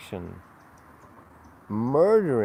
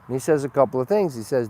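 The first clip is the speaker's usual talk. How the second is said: ordinary talk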